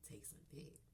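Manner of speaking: a soft, toneless whisper; whispered punchline to the audience; naughty whisper. Acted. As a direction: whisper it like a dirty secret. Naughty aside.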